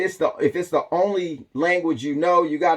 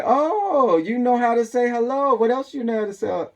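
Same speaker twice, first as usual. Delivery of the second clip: higher, excited mock voice; delighted salon worker; 7 semitones higher than usual. Vietnamese nail salon worker praising a customer's greeting, high and excited. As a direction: act impressed and eager for more. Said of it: "they will say".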